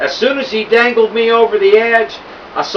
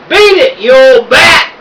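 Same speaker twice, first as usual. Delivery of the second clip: put-on loud, much higher yell at an old woman; rude yell; 5 semitones higher, 6 dB louder than usual. A narrator's reading. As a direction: bark it rudely at full volume. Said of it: "yelled Duke".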